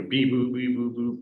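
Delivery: high, electronic-style beeps and boops; beep-boop like a fax line; fax machine noise. Retold or imitated. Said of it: "sounds like a fax machine".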